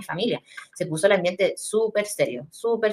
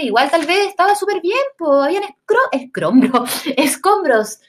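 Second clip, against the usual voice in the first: normal talking voice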